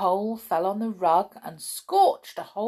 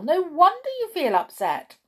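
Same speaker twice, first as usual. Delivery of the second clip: high, loud mock shock; shocked gossip; 11 semitones higher, 5 dB louder than usual. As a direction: clutch your pearls. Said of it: "who always loved to chat".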